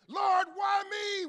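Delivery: high, loud, slow cry of Lord, why me; angry complaint. Retold or imitated. Believer complaining to God, angry loud wail. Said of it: "You're angry".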